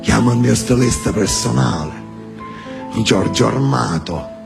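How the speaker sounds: low, menacing Sicilian boss; deep Sicilian mafia voice; Sicilian mafioso. Retold or imitated. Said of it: "Sentite l'originale".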